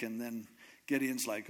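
normal talking voice

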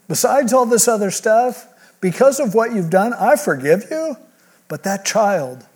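lifted, urgent paraphrase of God's verdict; emphatic retelling. Retold or imitated. Preacher voicing God's verdict to David, emphatic. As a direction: press it home with rising intensity.

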